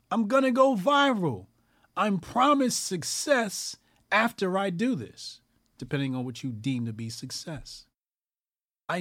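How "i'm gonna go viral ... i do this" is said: hammed-up boast of a sellout; mock grifter's boast. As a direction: play the smug sellout dreaming of going viral. Voicing the grifter's thoughts.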